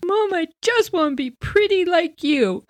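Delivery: imitating her small shocked self; scared little girl. Child answering mom.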